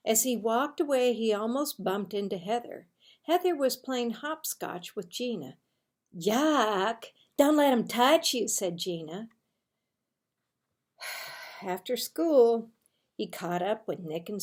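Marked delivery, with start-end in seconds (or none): 6.2-8.5 put-on loud, grossed-out girl; disgusted. A narrator's reading. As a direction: yell it like he's contagious. Girl recoils from Marvin.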